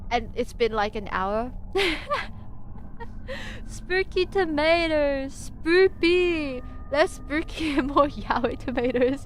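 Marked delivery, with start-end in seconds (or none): 1.6-3.6 high, wheezing real laughter; helpless laugh. Real, not acted. Laughs at the hour-long tangent. 3.7-9.2 high, drawn-out silly spooky voice; mock spooky. Mock-announces the supposedly spooky topic.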